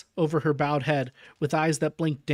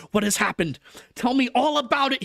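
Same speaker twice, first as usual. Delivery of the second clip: higher, louder urgent questions; urgent Harker; 9 semitones higher, 5 dB louder than usual. A narrator's reading. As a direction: demand answers at once. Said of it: "he cried".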